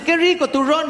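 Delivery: high mock praise voice; chirpy fake compliment; guests praising. Guests at the table, high mock compliment. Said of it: "todo el mundo dice".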